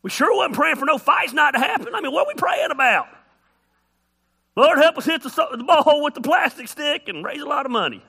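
high, loud mock disbelief; incredulous rant. Preacher asked to pray at a wiffle ball tournament, loud mock disbelief. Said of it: "I'm like".

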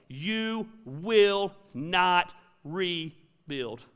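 drawn out, every word weighted; slow it right down to drive it home; slow emphatic warning. Real, not acted. Pastor warning the congregation, slow and weighted.

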